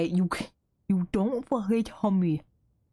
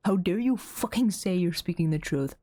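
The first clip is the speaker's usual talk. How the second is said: ordinary talk, nothing marked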